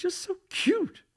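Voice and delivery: high, slow, cooing mock flirtation; flirty gushing. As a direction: imitate a flirty woman, high and drawn out. Retelling date flirting with waiter.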